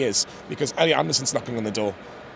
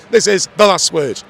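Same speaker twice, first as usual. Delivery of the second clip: slow, loud announcer read; announcer title; 4 semitones higher, 9 dB louder than usual. Real, not acted. Host announcing the segment title to viewers, slow loud announcer read. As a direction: boom out the segment name.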